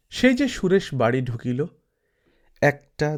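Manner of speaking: ordinary delivery, nothing marked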